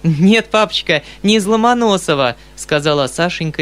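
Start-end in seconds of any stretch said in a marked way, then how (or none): 0.0-2.4 put-on high, louder girl's voice holding back mirth; amused daughter, pitched up; suppressing laughter. A narrator's reading. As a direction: tease papa while barely containing a laugh. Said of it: "едва подавляя свой смех".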